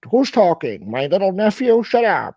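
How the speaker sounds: high, loud mocking impression of an angry caller; mock angry rant. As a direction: ham up his whiny outburst. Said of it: "and he says".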